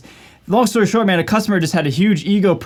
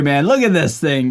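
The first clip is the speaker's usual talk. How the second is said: usual speaking voice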